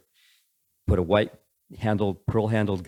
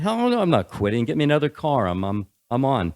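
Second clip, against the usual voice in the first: loud, high defiant retort; defiant shout; 6 semitones higher, 5 dB louder than usual. Retold or imitated. Telling his bosses he won't quit, a loud defiant shout. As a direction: bark it back, refusing to quit.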